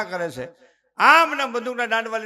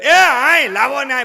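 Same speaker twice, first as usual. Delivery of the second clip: grandmother calling; 4 semitones higher, 10 dB louder, slower than usual. Retold or imitated. Grandmother wants the baby.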